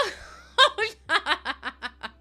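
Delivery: high burst of real laughter. Real, not acted. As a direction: crack up at your own story. Laughs at own retold quote.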